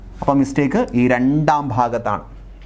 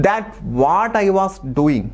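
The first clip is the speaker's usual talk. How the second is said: slow dictation read; 5 semitones higher, much slower than usual. Shown as a demonstration. Reading out exam sentence.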